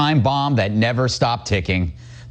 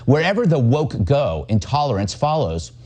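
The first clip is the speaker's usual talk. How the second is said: usual speaking voice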